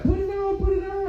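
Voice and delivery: high, loud playful taunt; teasing dad. Retold or imitated. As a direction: chase and tease in a high voice.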